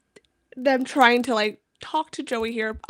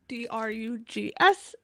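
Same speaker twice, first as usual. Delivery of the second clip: slow letter-by-letter spelling, quoting a character; spelled out; slower than usual. Retold or imitated. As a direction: spell it out slowly, letter after letter. Acted coy request spelt out.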